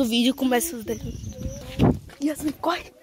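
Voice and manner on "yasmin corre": hushed, urgent whisper to run. Real, not acted. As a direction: whisper it so the seeker can't hear. Urging friend to run from seeker.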